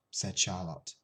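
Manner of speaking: ordinary delivery, nothing marked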